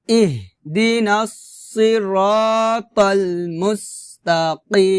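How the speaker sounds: "ইহদিনাস সিরাতাল মুস্তাকিম" with heavy sad and throaty qaf; tajweed demo of "ইহদিনাস সিরাতাল মুস্তাকিম", slow and sustained; slow Quran recitation. Shown as a demonstration. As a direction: stretch the long vowels of "সিরাতাল" and "মুস্তাকিম". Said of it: "আবার".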